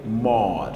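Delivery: "mor" slowly drawn out; stressed syllable alone. Shown as a demonstration. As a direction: isolate and stretch the syllable "mor". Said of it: "temos como sílaba tônica a última".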